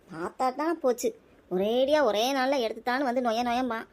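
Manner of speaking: usual speaking voice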